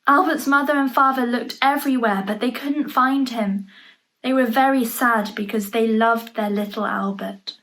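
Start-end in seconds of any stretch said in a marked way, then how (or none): none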